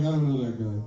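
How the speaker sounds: low-pitched God voice. Acted. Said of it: "And God's like".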